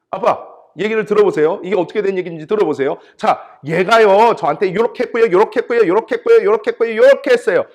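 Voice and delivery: put-on high, loud, insistent child's complaint; imitated tattling child. Child telling dad on a sibling, loud indignant tattling. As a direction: pile up grievances like an aggrieved kid. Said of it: "엄마 아빠한테 와서 이렇게 얘기합니다".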